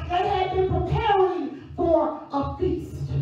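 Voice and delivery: loud, sung-out preacher cadence; preaching at full force. Acted. Preacher rousing the congregation, loud sung-out cadence. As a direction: lift the church with rising, chanted preaching.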